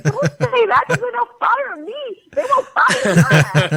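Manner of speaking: very high squealed plea, breaking into laughter; mock panicked protest. Actress retelling protest to co-star getting her in trouble, mock panic. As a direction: plead in a comic high panic.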